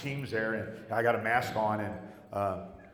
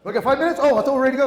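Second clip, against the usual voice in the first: much higher, louder surprised exclaim; real surprise; 13 semitones higher, 11 dB louder, much faster than usual. Real, not acted. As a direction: caught off guard, burst out high and loud. Surprised at time left.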